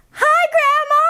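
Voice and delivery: put-on high shouting little girl. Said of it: "yells into phone".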